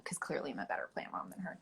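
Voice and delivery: conspiratorial whisper; whispered aside. Real, not acted. Guest jokes she out-gardens her mom, sly whisper. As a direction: whisper it so mom won't hear.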